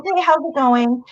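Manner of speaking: high, lively echoed hello; mirrored greeting. Retold or imitated. Coach mirroring a client, high and lively. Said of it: "I'm like".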